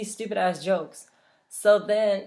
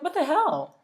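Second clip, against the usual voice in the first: angry disbelief; 10 semitones higher than usual. Retold or imitated. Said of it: "that made me so angry".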